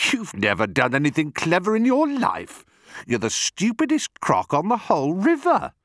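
high, heated insult; exasperated high crocodile voice; scornful not-so-big crocodile. Acted. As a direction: pour on contempt, climbing in pitch.

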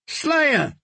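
put-on high, slurred drunken hail; drunken call. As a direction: hail him like a merry drunk. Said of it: "the singer called out drunkenly".

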